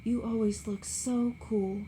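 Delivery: Daisy tells Gatsby she loves him; tender repeat. A narrator's reading. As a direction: say it like a confession of love. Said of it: "she repeated".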